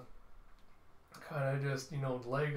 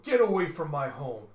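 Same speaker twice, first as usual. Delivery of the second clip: loud retold yell at the intruder; angry shout; 9 dB louder than usual. Father yelling at the vampire to leave his home, loud shout. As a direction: bark it out to drive the vampire off.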